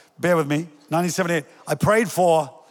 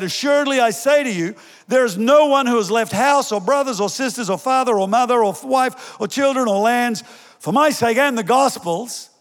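raised, ringing scripture read; lifted pulpit reading of the verse; 6 semitones higher than usual. Acted. Reads Jesus' words from Mark.

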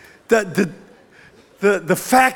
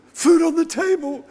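"Food" shouted high, falling off; hammed-up yell of wonder at "Food"; mock amazed shout; 7 semitones higher than usual. Pretend child marvels at the dinner table, hammed-up shout.

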